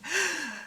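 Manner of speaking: short real laugh. Real, not acted. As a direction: chuckle in disbelief. Laughing at Martell.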